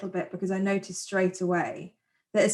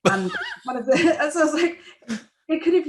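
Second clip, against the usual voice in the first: high, loud, laughing through the words; breaking up with laughter mid-sentence; 8 semitones higher, 6 dB louder, faster than usual. Real, not acted. Cohost recalling an annoying casting choice to her partner, laughing.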